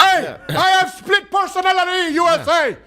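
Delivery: put-on high, slow Cuban-accented holler; Yoel Romero. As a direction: announce it in a mock Cuban accent. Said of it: "in this heavy accent".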